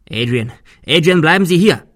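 loud shout rising in pitch; calling after someone. Acted. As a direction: shout to stop someone leaving. Calling after man running off.

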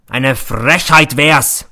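slow, loud roar of outrage; a principal hamming up his fury; loud, drawn-out bellow of mock outrage. A narrator's reading. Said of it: "brüllt er".

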